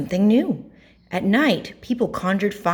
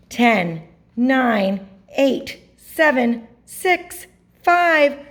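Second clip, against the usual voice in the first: countdown climbing steadily higher and louder; launch countdown; 10 semitones higher, 4 dB louder, much slower than usual. A narrator's reading. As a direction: build from normal voice to high, stretched liftoff. Reads a rocket launch countdown.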